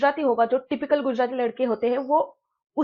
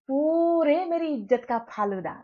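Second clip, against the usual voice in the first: ordinary delivery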